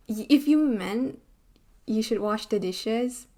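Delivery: ordinary delivery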